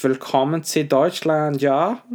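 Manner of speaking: hammed-up German accent, drawn out; camp German accent. Shown as a demonstration. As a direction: do a camp German, lifting the pitch. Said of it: "but automatically sounds camp".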